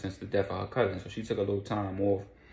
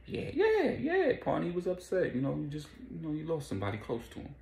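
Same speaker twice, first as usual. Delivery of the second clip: much higher, hurried cover-up voice; high nervous play-off; 7 semitones higher, 4 dB quieter than usual. Retold or imitated. Detective covering for partner, high and hurried. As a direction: squeak out the excuse like a cop covering.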